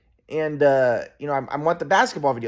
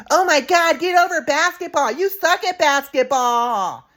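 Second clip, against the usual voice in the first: much higher, louder mocking mom voice; high scornful mom voice, dragged out slow; mocking mom impression; 13 semitones higher, 5 dB louder, slower than usual. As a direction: mimic mom in a high put-on falsetto. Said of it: "And my mom's like".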